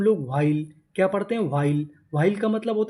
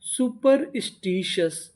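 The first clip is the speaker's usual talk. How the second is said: Superstitious higher and slowly broken into chunks; part by part; 4 semitones higher, slower than usual. Shown as a demonstration. Teacher puts the pieces together, superstitious slowly in chunks. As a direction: separate the parts of superstitious. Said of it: "superstitious का मतलब होता है".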